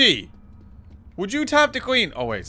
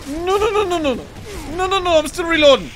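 panicked pleading; 7 semitones higher than usual. Real, not acted. Under attack while reloading.

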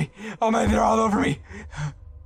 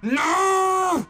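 high panicked yell; panicked shout; 13 semitones higher than usual. Pretend. Gamer fleeing the dog, high panicked yell. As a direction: yell as the dogs charge.

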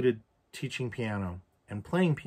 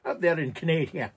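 higher, hammed-up accent with "Canada" as "ca-NAY-dee-a"; goofy, unplaceable put-on Canadian accent; mock Canadian accent; 5 semitones higher, 3 dB louder than usual. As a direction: ham up a silly northern accent. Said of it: "what the fuck that accent is".

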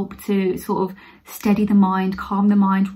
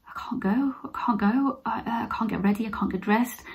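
soft, nearly whispered self-talk; retold helplessness; 7 dB quieter than usual. Vlogger reliving a bad anxiety day, hushed and helpless. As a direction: re-enact quietly giving up on the day. Retelling anxious paralysis at home.

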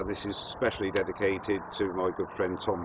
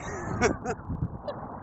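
breathless laugh; 12 semitones higher, 4 dB quieter than usual. Real, not acted. Still freezing after ice bucket.

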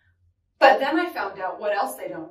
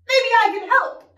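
high, loud offer; shouting over wind; 10 semitones higher, 6 dB louder than usual. Acted. Child offers help to pirates in storm, shouting. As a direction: pitch it up to carry across the deck. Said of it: "I shouted over the wind".

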